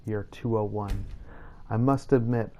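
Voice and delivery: normal talking voice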